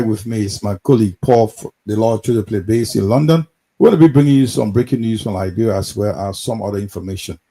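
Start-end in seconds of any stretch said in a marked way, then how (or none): none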